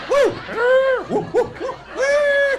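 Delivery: high, loud whoops; excited whooping. Real, not acted. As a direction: whoop it up like a party. Comic whoops at the crowd.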